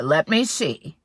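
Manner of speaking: loud, booming bear; loud hungry bear. Acted. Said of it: "said the bear".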